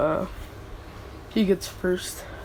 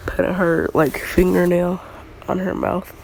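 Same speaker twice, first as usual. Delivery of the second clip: ordinary talk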